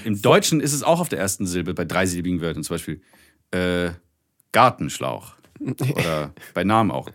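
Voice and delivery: ordinary talk, nothing marked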